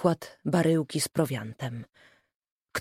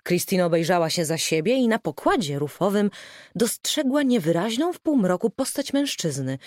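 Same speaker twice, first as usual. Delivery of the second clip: ordinary delivery, nothing marked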